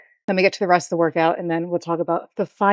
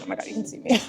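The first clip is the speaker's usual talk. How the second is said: whisper; 3 semitones higher, 4 dB quieter, much faster than usual. Real, not acted. Caught on camera at gym.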